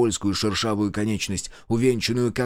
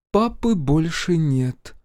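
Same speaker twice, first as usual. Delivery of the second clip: higher, slow, drawn-out words; strange, alien voice; 5 semitones higher, much slower than usual. A narrator's reading. Mother tells son about father, alien voice. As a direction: spell it out like a stranger. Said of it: "ответила мама чужим голосом".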